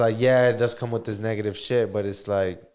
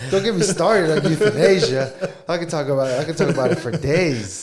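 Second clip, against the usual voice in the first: higher, louder stoner character voice; movie character voice; 4 semitones higher, 4 dB louder than usual. Retold or imitated. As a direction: do the stoner guy, laughing. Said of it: "when he walks in and he's like".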